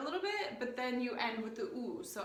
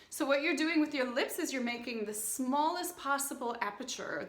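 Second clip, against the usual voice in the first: ordinary talk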